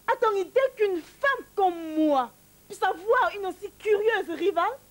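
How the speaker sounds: high, rising, near-tearful indignation; outraged wife on the edge of tears; indignant outburst. Acted. Wife venting about her rival, high indignant outburst.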